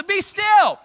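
high loud be still; parent yelling. Retold or imitated. Parent to kids dancing behind his back, loud high yell.

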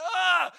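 high, loud imitation crying cry; loud mock wail. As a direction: wail out a hammed-up sob. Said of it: "This is someone crying so loud".

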